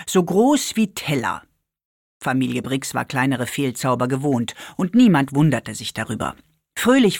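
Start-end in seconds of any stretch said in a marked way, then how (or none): none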